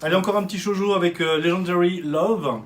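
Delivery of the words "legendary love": effortful English accent. Shown as a demonstration. Co-host announces a shoujo title, careful English accent. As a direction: make a show of pronouncing it in English. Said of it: "J'ai fait un effort de, d'anglais".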